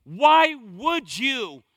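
slow, loud scolding "How could you?"; mock scolding. Preacher voicing a scolding God. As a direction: drag it out like a disappointed scold. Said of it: "He doesn't say to you".

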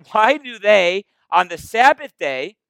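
slow, loud, high indignant accusation; mock-outraged religious scolds; scandalized Pharisees. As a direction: drag it out, outraged and pointing. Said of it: "they say".

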